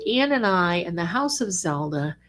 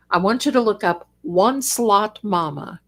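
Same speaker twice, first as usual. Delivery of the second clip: ordinary delivery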